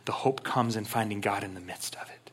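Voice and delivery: hushed, intimate whisper; whispered conviction. Real, not acted. Preacher whispering the key truth to the congregation. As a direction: lean in and whisper the point.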